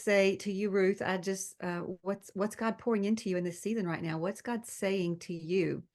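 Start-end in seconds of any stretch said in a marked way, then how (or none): none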